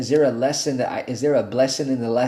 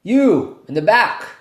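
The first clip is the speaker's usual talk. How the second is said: high, loud call across the room at someone in the back; 7 semitones higher, 7 dB louder than usual. Retold or imitated. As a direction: shout across the crowd to pick someone out. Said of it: "he goes".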